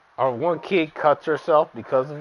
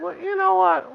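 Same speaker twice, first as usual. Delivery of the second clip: mock high retort.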